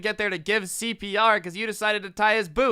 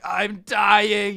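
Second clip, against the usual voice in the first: loud, raised mock protest; mock dying cry; 2 semitones higher, 6 dB louder than usual. Patient waiting on the ambulance crew, mock outcry.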